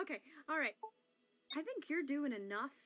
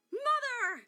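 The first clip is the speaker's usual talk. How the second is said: crying call; 7 semitones higher, 8 dB louder, slower than usual. Acted. Calling mother, crying.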